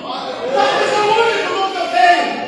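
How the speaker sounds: building to a loud, ringing sermon climax; impassioned preaching. Real, not acted. Preacher confesses his love of preaching to the church, impassioned and building.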